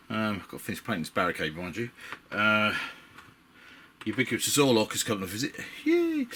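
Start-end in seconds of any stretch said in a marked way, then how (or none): 5.8-6.3 high squeal of joy; excited cheer. Real, not acted. Cheering a visitor's arrival.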